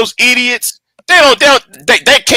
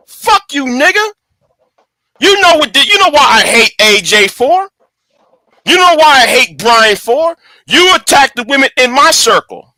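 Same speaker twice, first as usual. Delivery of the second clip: higher, heated rant; angry outburst; 6 semitones higher than usual. Real, not acted. Ranting to the listener about the friend who betrayed him, heated and angry. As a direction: let the anger boil over and spit it at him.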